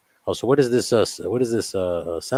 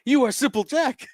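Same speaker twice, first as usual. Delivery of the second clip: high mocking voice; 13 semitones higher than usual. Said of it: "quoting the movie".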